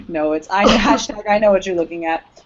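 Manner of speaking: ordinary talk, nothing marked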